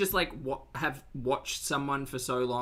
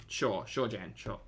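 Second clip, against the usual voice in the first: sarcastic; 4 semitones lower, 6 dB quieter than usual. Real, not acted. Dismissive mock agreement.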